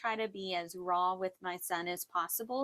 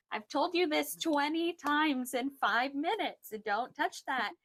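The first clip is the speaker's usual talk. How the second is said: high-pitched mock telling-off of a child; imitated mom scolding, pitched way up; exasperated scolding; 8 semitones higher, 3 dB louder than usual. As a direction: re-enact a fed-up parent at wit's end. Acting out scolding her son.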